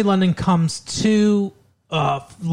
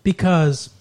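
ordinary talk, nothing marked

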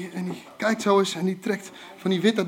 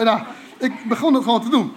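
ordinary talk, nothing marked

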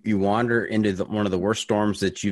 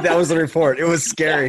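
laughing; 7 semitones higher, 5 dB louder than usual. Real, not acted.

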